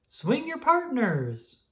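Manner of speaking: high, slow call over the music. Acted. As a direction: sing it out high to the dancers. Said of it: "Pa called".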